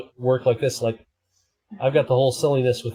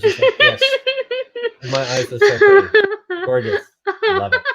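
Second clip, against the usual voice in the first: unipony voice; 4 dB louder than usual. Pretend. Streamer in unicorn filter gushing over eyeshadow, high squeaky voice. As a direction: squeal it like a vain unicorn pony.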